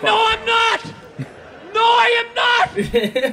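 loud, high-pitched frantic denial; panicked mock shouting. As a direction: scream it back in comic panic. Panicked denial after nightmare.

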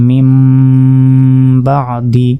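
"মিম" held on a long hummed m, then "বা দি"; qalb with ghunna. Shown as a demonstration. Teacher repeating the corrected word, qalb with ghunna. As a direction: stretch the final m of "মিম" before the b. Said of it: "মিম দ্বারা পরিবর্তন করে".

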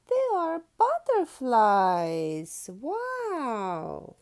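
loud, slow, delighted reveal; excited reveal. Acted. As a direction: announce it like magic. Big reveal to children.

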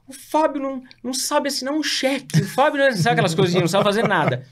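high-pitched imitation of a complaining mom; mock motherly complaint, high; fretting mother. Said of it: "minha mãe".

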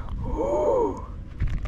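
sudden high-pitched gasp. Real, not acted. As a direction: gasp in shock. Landing the big bass.

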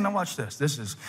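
usual speaking voice

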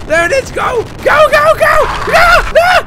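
very high, very loud frantic screams; panicked screaming. Real, not acted. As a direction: scream as it catches you. Final dash, caught by monster.

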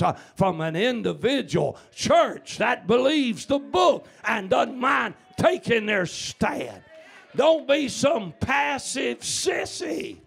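loud, driving, rhythmic sermon; full-force revival preaching. Real, not acted. Preacher rousing the church to revival, fervent. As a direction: preach it hard with punched key words.

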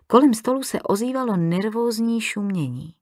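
plain reading voice

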